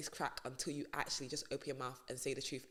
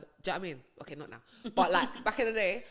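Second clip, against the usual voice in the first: ordinary talk, nothing marked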